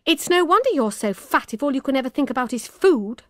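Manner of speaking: a touch of irritated snap; snapping retort. A narrator's reading. Poppy snapping at Emma about food, mildly irritated. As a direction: bite back at her, mildly cutting. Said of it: "snapped Poppy".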